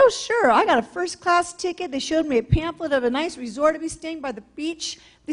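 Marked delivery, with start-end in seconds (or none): 0.0-0.5 eager yes. Retold or imitated.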